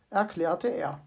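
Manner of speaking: ordinary delivery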